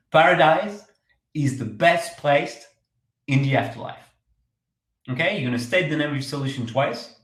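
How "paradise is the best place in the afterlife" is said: slow, deliberate tagline with pauses between phrases; unhurried pitch line, spaced out; slow, memorable announcement. Shown as a demonstration. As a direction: announce it slowly so people remember it. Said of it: "Say it very slowly".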